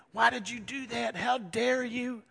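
high, loud mock outrage; indignant scolding. Joseph holding it over the brothers' heads, acted indignation.

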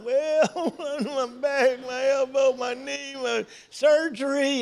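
high-pitched, hammed-up litany of aches; aching old complainer. Aging man answering how he's doing, aching complaint. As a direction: moan comically through every ailment.

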